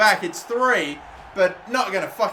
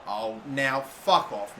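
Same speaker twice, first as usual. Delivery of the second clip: normal talking voice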